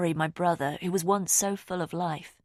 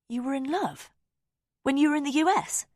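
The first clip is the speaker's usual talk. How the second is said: astonished question; 10 semitones higher, 3 dB louder than usual. Acted. Sister hears a secret.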